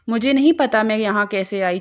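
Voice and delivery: ordinary delivery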